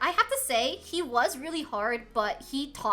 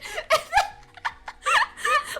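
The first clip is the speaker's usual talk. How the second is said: long high laughing fit; 6 dB louder than usual. Real, not acted. Laughing at own story.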